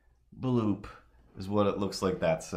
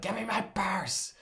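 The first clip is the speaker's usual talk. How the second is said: yelling neighbor; 13 semitones higher than usual. Retold or imitated. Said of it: "I heard was like".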